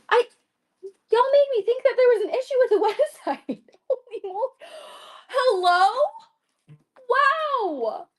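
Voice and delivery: high, loud, excited disbelief; overwhelmed and thrilled; shaken excitement. Real, not acted. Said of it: "I'm shaking right now".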